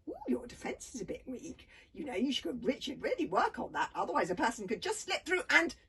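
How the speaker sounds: teasing. A narrator's reading. Girl mocking his defence mid-fight.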